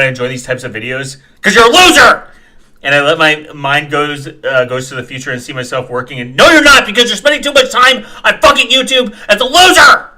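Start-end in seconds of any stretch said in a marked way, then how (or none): 1.4-2.2 loud, high jeer; shouted insult. Real, not acted. 6.3-10.1 loud, high rebuttal; angry shout. Real, not acted. Shouting back at commenter.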